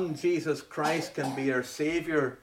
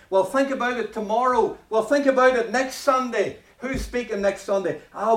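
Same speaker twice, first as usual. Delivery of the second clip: lightly voiced putting-off excuses, rising higher; procrastinating listener; 7 semitones higher, 5 dB louder than usual. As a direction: brush off the preacher, delay it. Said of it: "some of them said".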